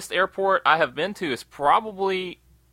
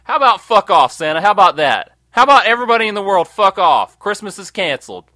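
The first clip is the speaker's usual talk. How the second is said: loud, indignant negotiating; louder mock-outraged Rudolph voice; pretend defiant Rudolph; 3 semitones higher, 6 dB louder than usual. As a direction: Rudolph telling Santa off. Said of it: "I'd be like".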